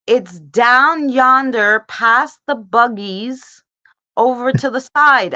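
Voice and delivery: imitating Southern drawl. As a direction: mimic the Southern store lady slowly. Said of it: "she said".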